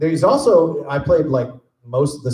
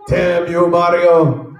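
higher, louder hammed-up curse shaking a fist at Mario; mock villain curse; 5 semitones higher, 5 dB louder, much slower than usual. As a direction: ham it up like a cartoon villain.

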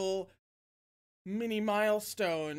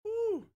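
shaky exhale; 13 semitones higher than usual. Real, not acted. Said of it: "It's fine".

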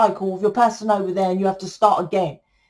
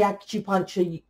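ordinary delivery

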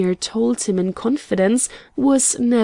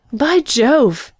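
louder, higher excited exclamation; excited cry; 4 semitones higher, 5 dB louder than usual. A narrator's reading. Carlton hears the princess is in London, excited cry. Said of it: "cried Carlton excitedly".

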